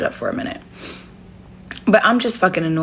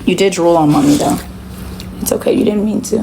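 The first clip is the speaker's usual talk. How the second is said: usual speaking voice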